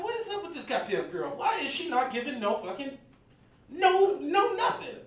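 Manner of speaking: incredulous. Retold or imitated. Incredulous at silent girl.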